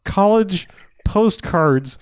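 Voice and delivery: "college postcards" drawn out slowly; slow stressed phrase. Real, not acted. Quoting the friend's odd phrase, slow and stressed. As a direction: stretch "college postcards" in disbelief. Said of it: "the phrase".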